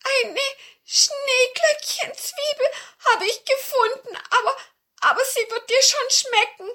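very high, loud whimpering dwarf; hammed-up tearful little dwarf; crying dwarf. A narrator's reading. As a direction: stammer tearfully in a squeaky voice. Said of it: "weinte der arme Zwerg".

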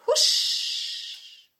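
langes, gehauchtes Huschen; Windgeräusch. A narrator's reading. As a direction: das Wort wie einen Windstoß auszischen lassen. Ahmt den Windstoß nach. (husch wie vorbeifegender Wind)

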